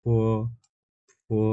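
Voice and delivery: ফো said with a light puff of air on the f sound; ফো with an audible breath at the start; breathy demo. Shown as a demonstration. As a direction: blow a little air out on ফো. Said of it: "এখানে হালকা একটু বাতাস বের হবে".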